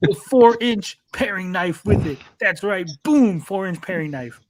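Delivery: overexcited infomercial pitch; TV shopping pitchman. Pretend.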